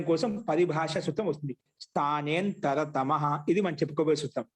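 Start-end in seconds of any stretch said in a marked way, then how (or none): 1.8-3.4 slow, measured sutra recitation. Shown as a demonstration. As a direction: recite it slowly for the class to follow.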